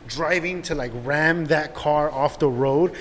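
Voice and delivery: ordinary delivery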